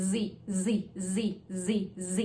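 "Z" with teeth together and a buzzing z sound. Shown as a demonstration. Teacher demonstrating the "Z" buzz to learners. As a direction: buzz the "Z" through closed teeth. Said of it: "แล้วก็ออกเสียง Z".

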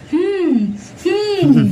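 high, drawn-out "hmm", mimicking a baby; mock a nosy baby's rising-falling hum; imitated peering hum. Mimicking the baby peering at passers-by, high humming. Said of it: "ঝুঁকে দেখবে".

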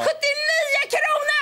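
high, stretched squeal of astonishment; mock amazement. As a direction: exclaim it slowly as if it were unbelievable. Repeats price in exaggerated amazement.

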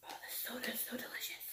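soft breathy whisper. Real, not acted. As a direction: whisper it to yourself. Whispered aside while tasting.

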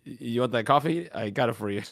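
usual speaking voice

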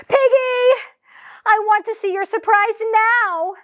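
high, loud impatient yell. Acted. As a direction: yell at your friend. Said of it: "kept getting yelled at".

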